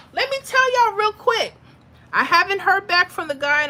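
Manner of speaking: high, bright announcement to the viewers; excited. Real, not acted. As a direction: pitch up with excitement.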